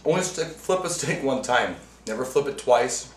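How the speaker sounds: ordinary talk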